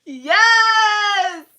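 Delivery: long, high squealed shout; ecstatic shout. Real, not acted. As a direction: scream it out, swooning and drawn-out. Gushing over a crush's voice.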